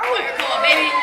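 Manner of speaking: shouted, hyped up; loud hype shout. Real, not acted. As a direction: yell it out to the crowd. Shout-out to fellow firefighters.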